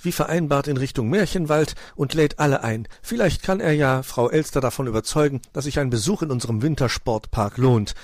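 breezy, untroubled suggestion; casual. A narrator's reading. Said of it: "sagte Puh leichthin".

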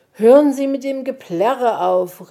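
laut und höher rufen; verzweifelter Ruf. A narrator's reading. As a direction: voller Verzweiflung dagegen anschreien. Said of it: "rief er voller Verzweiflung". (Puh ruft verzweifelt laut gegen den Gesang)

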